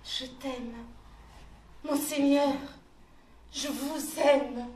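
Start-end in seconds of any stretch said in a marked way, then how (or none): 1.8-4.7 louder, with aime drawn out slow; loud fervent declaration. Acted. She declares love to her lord, loud and fervent. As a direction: declare it full-voiced and fervent.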